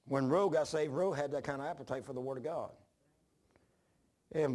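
ordinary delivery

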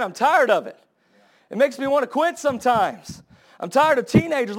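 stirring pulpit crescendo; fired-up preaching. Acted. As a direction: preach it with rising fire. Preacher building intensity.

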